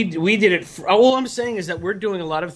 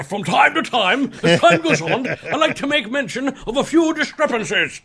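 mock grand orator; 5 semitones higher than usual. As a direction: declaim it like the indignant arrestee. [name] slips into the clip's voice.

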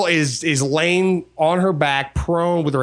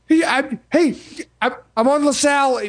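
panicky caller; 9 semitones higher than usual. Retold or imitated. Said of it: "she's just like".

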